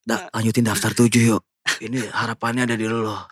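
low, fading last words; dying movie hero. Retold or imitated. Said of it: "kayak film-film yang gitu".

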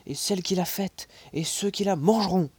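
building to a loud furious shout; angry outburst. Acted. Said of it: "s'écria mon oncle".

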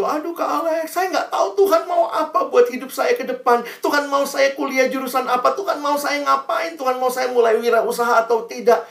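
put-on high, pleading teen voice; mock-worried student pleading; whiny student.

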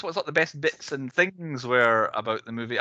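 ordinary delivery

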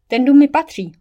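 loud, high, sharp snap; snapped retort. Acted. Fire dancer snaps at Derry, loud and high. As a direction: bite the words off like a claim. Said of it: "ocekla teď ohnivá tanečnice".

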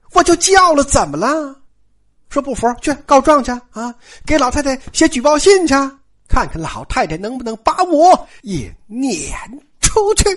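high-pitched, challenging retort; angry defiance. Acted. Maid defiantly answers an accusation. (高声顶撞，满不在乎)

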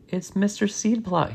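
high-pitched, urgent but contained yell; mock yell. A narrator's reading. Student spots the old teacher, mock yell. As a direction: cry out in alarm without real volume. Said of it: "he yelled".

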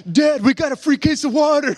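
high, loud imitation of a delighted boy; excited kid. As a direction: blurt it out to Dad like a thrilled child. Acts his delighted young son.

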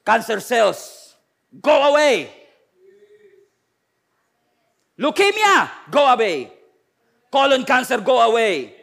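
slow, loud commands to sickness; commanding declaration. Shown as a demonstration. Pastor modeling how believers rebuke sickness, loud command. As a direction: order the illness out with authority. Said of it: "you say it with authority".

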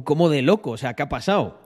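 normal talking voice

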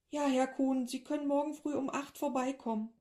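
doctor's receptionist. A narrator's reading. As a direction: answer politely as the practice. Doctor's office on phone.

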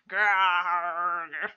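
loud rolling growl like a zombie. Retold or imitated. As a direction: growl it out, hungry undead. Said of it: "some sort of zombie noise".

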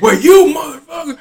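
high-pitched mock angry caller; getting loud. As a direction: imitate an irate customer sputtering into the phone. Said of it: "He's still getting loud".

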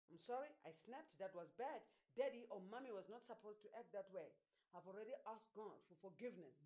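soft, low, subdued apology; gentle apology. Acted. Preacher models a parent apologizing to their child, gentle apology. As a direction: say sorry quietly and sincerely.

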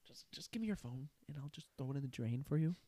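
soft, quick flat threat; mock deadpan. Son telling dad to hand over the phone, soft deadpan. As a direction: mutter it dryly. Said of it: "I'm like".

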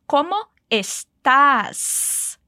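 very slow, deliberate Spanish phrase, every syllable clear; slow, careful model phrase for the learner. Shown as a demonstration. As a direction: spell out estás slowly so the final S is heard. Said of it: "com um Szinho no final".

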